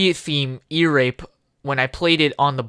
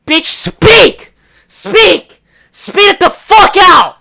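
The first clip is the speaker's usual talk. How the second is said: loud, much higher shout; mock yelling; 12 semitones higher, 11 dB louder, slower than usual. Ranting about stammerers, loud high yell. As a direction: yell at them to spit it out.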